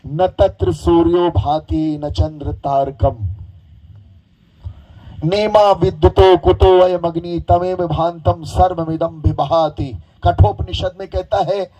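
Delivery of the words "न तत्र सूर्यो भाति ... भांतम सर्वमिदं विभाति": slow, measured chanting of the verse; शांत, धीमा मंत्रपाठ; Sanskrit recitation. Acted.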